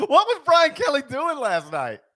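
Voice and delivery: loud, high, slow laughing question; real amused exasperation; laughing disbelief. Real, not acted. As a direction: cry out incredulously through laughter. Laughing disbelief at co-host's impression.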